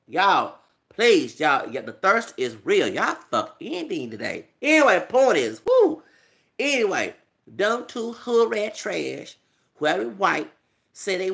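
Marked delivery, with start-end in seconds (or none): 5.7-6.0 high, loud whooping cry; a loud high woo of disbelief; excited whoop. Real, not acted. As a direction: let out a sudden mocking whoop. Mocking the women's thirst.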